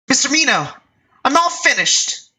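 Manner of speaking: put-on high young woman announcing she's done; cheerful girl. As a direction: call out happily.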